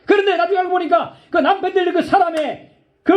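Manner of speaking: high, laughing wonder at the news; excited laughing reveal. Real, not acted. Preacher tells the congregation who the father-in-law was, high and laughing. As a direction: share the surprise with delight. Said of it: "laughing surprised reveal".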